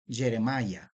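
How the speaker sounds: "Jeremiah" said the English way, jeh-reh-MY-ah; English pronunciation. Shown as a demonstration. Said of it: "así se pronuncia en inglés".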